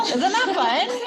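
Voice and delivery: real delight at the sounds. Real, not acted. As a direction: gush with delight.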